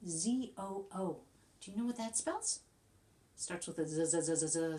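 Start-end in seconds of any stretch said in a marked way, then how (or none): 4.0-4.8 z, z, z, z as a long buzzing zzz; buzzing z sound. Shown as a demonstration. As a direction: sound out the z like a bee. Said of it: "z sound".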